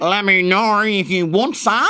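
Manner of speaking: slow, high, loud Australian; exaggerated Australian with rising end; mock Australian accent. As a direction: stretch it in broad Australian. Like an Australian coming in.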